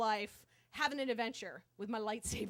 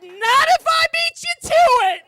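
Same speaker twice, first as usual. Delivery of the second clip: very high, full-volume shout; triumphant high yell; yelled comeback; 21 dB louder than usual. Retold or imitated. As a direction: holler back before the doors close. Yelled comeback as doors close.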